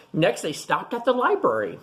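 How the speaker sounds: plain reading voice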